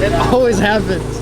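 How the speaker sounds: excited. Real, not acted. Laughing excitement continues.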